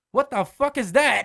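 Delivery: loud, high, drawn-out outburst; slow, booming retold yell; angry dad. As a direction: demand it like an outraged father. Voices angry dad's reaction.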